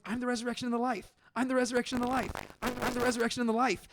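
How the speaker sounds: insistent Jesus. Retold or imitated. Jesus repeating himself to unbelieving crowd.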